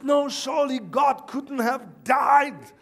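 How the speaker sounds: loud, high, drawn-out mock denial; retold emphatic protest. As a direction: act out an insistent preacher husband. Preacher husband argues with his wife.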